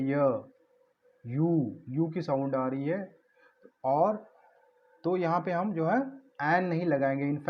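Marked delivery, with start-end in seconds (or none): 0.0-1.8 य then यू, each said alone as a y sound; isolated sound demo. Shown as a demonstration. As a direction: sound out the y at the start. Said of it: "य की sound आ रही है". (य और यू की ध्वनि अलग से बोलना)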